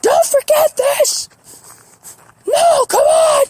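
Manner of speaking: very high, strained falsetto yell; hammed-up high-pitched hollering; mock yelling. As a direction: plead with them in a squeaky shout. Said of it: "I'm, like, yelling at 'em".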